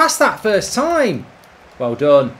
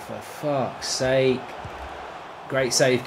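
ordinary delivery

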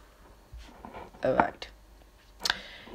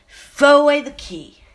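high loud jeer; triumphant shout; 9 semitones higher, 13 dB louder than usual. Acted. Accuser demanding the clown be jailed, shouting. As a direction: shout it gleefully.

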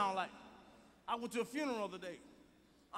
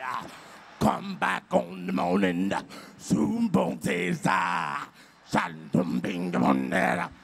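slow, loud, very low garbled sermon growl; growling preacher; 6 semitones lower, 7 dB louder, slower than usual. Retold or imitated. Mocking ministers nobody can understand, growling preacher holler. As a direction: holler nonsense scripture, punching out each "ah". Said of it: "all that fucking growling and shit".

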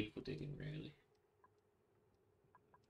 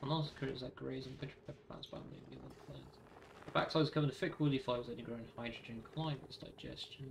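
ordinary talk

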